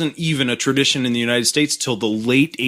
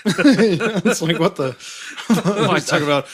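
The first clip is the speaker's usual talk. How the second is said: laughing; 7 semitones higher than usual. Real, not acted. Laughing retelling joke.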